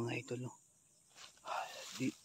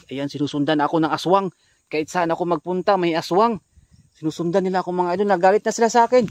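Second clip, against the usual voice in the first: louder, urgent play-by-play of the chase; excited alarm; 4 semitones higher, 15 dB louder than usual. Pretend. Vlogger tells viewers the aswang is following him, loudly excited. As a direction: tell the viewers it's chasing you, pumped up.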